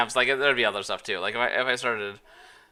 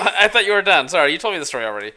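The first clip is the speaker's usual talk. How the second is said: flustered apology; 7 semitones higher, 11 dB louder, much faster than usual. Real, not acted. Caught cutting her off.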